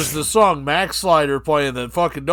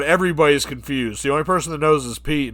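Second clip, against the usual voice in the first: ordinary talk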